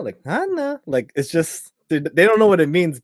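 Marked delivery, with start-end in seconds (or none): 0.2-0.8 put-on high, lilting name call to a baby; melodic baby-greeting. Shown as a demonstration. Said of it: "the melody of your voice".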